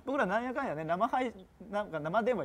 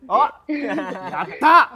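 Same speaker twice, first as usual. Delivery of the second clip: giddy happy laughing, much louder; delighted laugh; 7 semitones higher, 15 dB louder, much slower than usual. Real, not acted. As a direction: burst out laughing with joy. Praised by caller, delighted.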